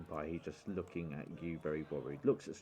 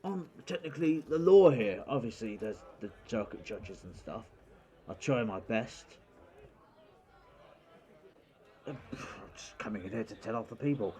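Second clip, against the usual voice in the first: lightly voiced sheriff, louder at times; a hint of a self-important lawman; 2 semitones higher, 8 dB louder than usual. Sheriff explains himself.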